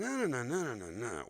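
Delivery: high, loud "da-na-na" sentence tune; hummed speech melody. Shown as a demonstration. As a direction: trace a line's pitch contour in nonsense syllables. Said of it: "We're hearing the melody, the pitch".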